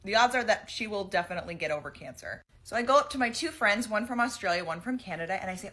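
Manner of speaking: normal talking voice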